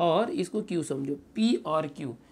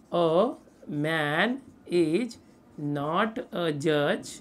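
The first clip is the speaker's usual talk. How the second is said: very slow, word-by-word delivery; dictating; 2 semitones lower, much slower than usual. Real, not acted. Teacher gives students the negated statement, dictating slowly. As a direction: pause between words for writing.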